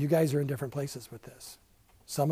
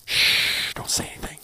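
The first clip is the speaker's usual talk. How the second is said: hushed whisper; 7 dB louder than usual. Pretend. Pastor to class, playful secret whisper.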